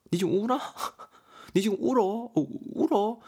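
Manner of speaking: high, laughing tease in Gyeongsang dialect; mock someone for crying, laughing; teasing laugh. Mijeong teasing a crying friend, high laughing voice. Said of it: "이렇게 말을 하고 있습니다".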